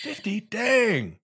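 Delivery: high, amazed exclamation; impressed surprise. Real, not acted. Coworker hearing of the fifty percent discount, impressed and surprised. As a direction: jump up in pitch, truly impressed.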